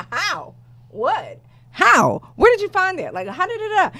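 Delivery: high, rising string of stunned questions; retelling the cancer news, high and stunned; shocked disbelief. Retold or imitated. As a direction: fire off questions in pure disbelief. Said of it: "I was like".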